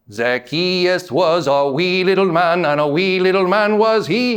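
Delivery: put-on old church song in a lilting Irish accent; Irish accent, sing-song. Preacher quoting a church song for the congregation, mock Irish accent. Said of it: "say it in an Irish accent".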